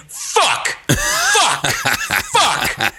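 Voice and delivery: put-on high frustrated kid cursing; escalating squeaky swearing. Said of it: "I kept saying".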